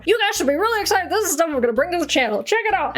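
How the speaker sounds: high-pitched promo excitement; mock hype. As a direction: hype it up like an over-eager promoter. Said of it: "I should be hyping this up more".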